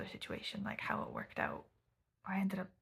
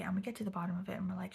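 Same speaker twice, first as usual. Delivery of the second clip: ordinary delivery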